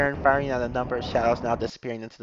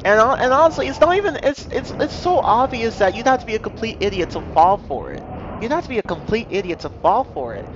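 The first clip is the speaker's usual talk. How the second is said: exasperated; 7 semitones higher, 3 dB louder than usual. Real, not acted. Exasperated rant at viewers.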